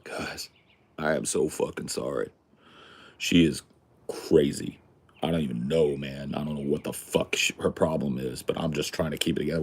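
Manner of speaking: low, hushed retold cook; confiding apology. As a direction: lean in and apologize quietly, low.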